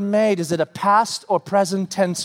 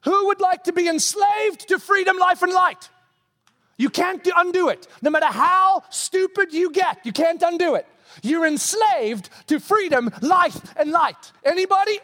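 preaching at full force; 11 semitones higher than usual. Real, not acted. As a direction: preach it out with fire to the room. Preacher building to full-force shout.

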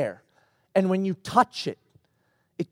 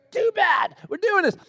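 high-pitched exasperated parent; mock firm overruling; 10 semitones higher than usual. Father overruling daughter, mock exasperated firmness. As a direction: shut down the protest, acted.

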